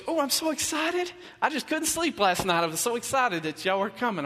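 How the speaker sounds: high, gushing church lady voice; gushing excitement. Retold or imitated. Church lady gushing to the pastor on the phone, high and excited. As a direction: gush like she couldn't sleep for joy.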